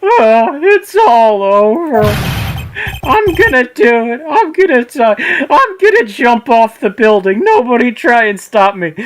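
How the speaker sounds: high, loud hammed-up wail; Larry wails to Phoenix in hammed-up despair; despairing shriek. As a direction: scream it like a dramatic boyfriend. Larry wailing about dying.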